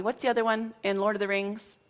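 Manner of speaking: normal talking voice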